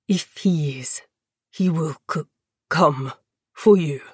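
low, halting, stammered words; pained conviction. A narrator's reading. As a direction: insist through pain, words catching. Weak prisoner insisting through pain.

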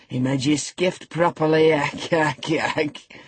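slow Yorkshire old man, mocking, with a croaking laugh; gloating old servant. A narrator's reading. Joseph mocking Linton by the fire, gloating old voice. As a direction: gloat over the boy's beating. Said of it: "malignant, crackly laugh".

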